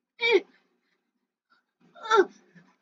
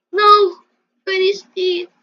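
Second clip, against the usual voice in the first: loud dismay; 2 semitones higher, 12 dB louder than usual. Real, not acted. Player seeing Freddy destroyed, loud dismay. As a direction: yell out at the wreckage.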